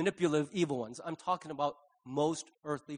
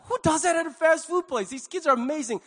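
high, loud disbelief; amazed; 9 semitones higher, 4 dB louder than usual. Retold or imitated. Customer retelling his reaction to attentive workers, amazed. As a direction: marvel aloud with raised pitch.

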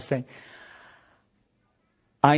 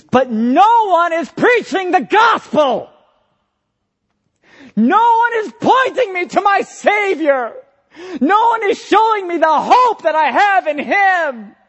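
much higher, louder impassioned cry; preaching at full force; 13 semitones higher, 5 dB louder than usual. Real, not acted. Preacher crying out to the church that no one points to Christ, loud. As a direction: cry out the lack of gospel.

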